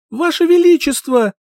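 high, loud cry for help; desperate shout. Acted. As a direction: scream it with all your strength. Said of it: "Закричала, что было сил".

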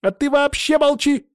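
very high, loud snap; barked order. A narrator's reading. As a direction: shut him up with a sharp, high yell. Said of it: "гаркнула лекарка". (резкий окрик лекарки, очень высоко)

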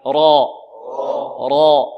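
loud, full-mouthed emphatic را; thick, dark "raw" sound on را; heavy ra. Shown as a demonstration. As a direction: model را heavy, for the class to repeat. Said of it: "انطقوا معي".

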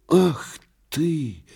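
high indignant exclamation; outraged cry. Acted. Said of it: "вскричал во сне Турбин". (возмущённый выкрик во сне)

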